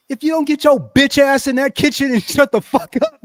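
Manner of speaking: loud, high, fired-up yelling; a loud retold telling-off; retold angry yell. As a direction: tell him off at full volume, laughing at the end. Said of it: "I was fired up at four in the morning".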